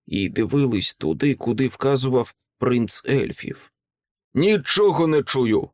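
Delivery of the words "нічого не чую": ordinary delivery, nothing marked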